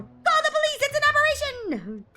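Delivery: very high, loud alarm; panicked character cry; panicked shout. Acted. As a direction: shriek it like a scared president.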